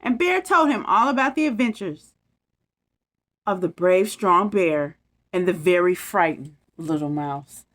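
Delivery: put-on lively bedtime story read to kids; story narration. A narrator's reading. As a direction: read it like a storybook for little ones. Reads bedtime story aloud.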